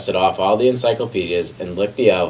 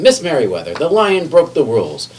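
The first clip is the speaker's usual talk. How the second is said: higher, insistent report; indignant tattling; 7 semitones higher, 3 dB louder than usual. A narrator's reading. McBee reporting the lion, higher and indignant. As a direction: tell on the lion breathlessly.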